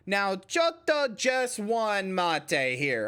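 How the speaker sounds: very slow, exaggerated Southern drawl; mock Southern-accented Japanese. Streamer playing a Southern caller, exaggerated mock drawl. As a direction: stretch every word in a hammed-up Southern accent. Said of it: "Japanese in a southern accent".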